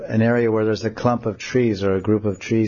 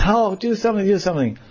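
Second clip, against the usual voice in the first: put-on high, pleading girl voice; high desperate Radha; begging girl; 10 semitones higher than usual. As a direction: beg Krishna to help. Radha begs.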